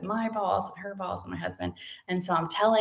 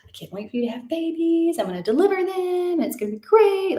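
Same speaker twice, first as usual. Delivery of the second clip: imitating excited mom; 10 semitones higher than usual. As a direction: play the mom who can't wait for grandbabies. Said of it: "she was always like".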